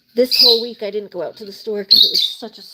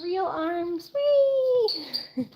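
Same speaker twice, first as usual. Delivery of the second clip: excited squeal; 9 semitones higher, 3 dB quieter, much slower than usual. Real, not acted. Shows off her real arms.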